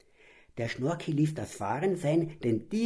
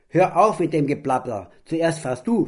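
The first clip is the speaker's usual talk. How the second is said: loud retort; 5 semitones higher, 8 dB louder than usual. Acted. Father retorts to the scolding.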